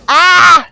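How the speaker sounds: short high yelp of panic; loud startled "ah"; panicked scream. Real, not acted. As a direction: cry out in alarm. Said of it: "I'm panicking!".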